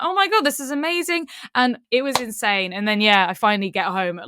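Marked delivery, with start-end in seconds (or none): 0.0-1.3 much higher, fast thrilled gush; mock delighted gush. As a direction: gush like it's the best surprise ever. Delighted at meeting friends.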